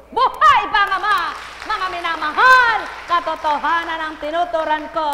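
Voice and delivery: high, loud triumphant proclamation; preaching shout. Acted. Preacher proclaiming to the faithful at full force. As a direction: proclaim it so the back row rings.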